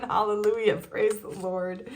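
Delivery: hammed-up worshipful cry, praise the Lord drawn out slowly; playful mock rapture; mock ecstatic cry. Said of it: "that you're screaming".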